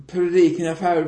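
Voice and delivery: normal talking voice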